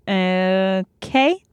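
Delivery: drawn-out, doubtful okay; hesitant agreement. Retold or imitated. Woman answering a sudden love confession, hesitant. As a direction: stretch it out, rising at the end.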